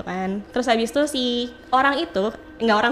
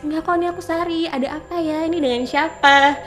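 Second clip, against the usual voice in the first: higher, lightly put-on innocent phone voice; insisting as Sari; 5 semitones higher than usual. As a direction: keep up the pretence, pitched up.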